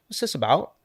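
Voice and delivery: lightly mock British accent; a quick, admittedly bad stab at a British accent; half-hearted British accent imitating a girl on the street. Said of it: "That's a horrible accent".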